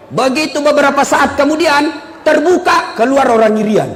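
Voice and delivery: ordinary delivery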